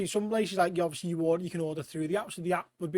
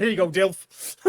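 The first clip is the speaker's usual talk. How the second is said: flattering; 5 dB louder, slower than usual. Pretend. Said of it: "I'd boost his pride".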